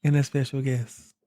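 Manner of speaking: mock radio presenter, slowing on the last phrase; imitated radio voice. Teasing co-host for her intro, imitated radio voice. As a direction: lightly mimic her radio delivery. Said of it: "She hit you with the radio voice".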